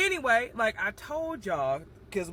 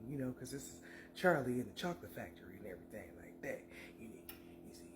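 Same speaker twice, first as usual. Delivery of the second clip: softer, lower confiding voice; hushed gossip; 6 semitones lower, 11 dB quieter than usual. Real, not acted. Telling viewers what he looked up, hushed confiding tone. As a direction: lean in and share a nosy secret.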